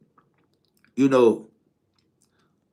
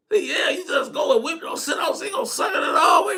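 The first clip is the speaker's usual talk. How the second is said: imitating hyped friend; 6 semitones higher, 4 dB quieter than usual. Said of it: "they would be doing little shit like".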